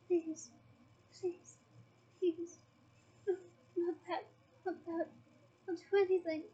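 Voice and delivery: broken, tearful begging. Acted. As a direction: beg with a catch of crying in the voice. Dumbledore begging under the potion.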